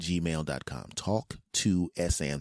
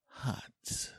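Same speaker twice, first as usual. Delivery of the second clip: dramatic gasp; 10 dB quieter than usual. Acted. Mock gasp on hot breakfast.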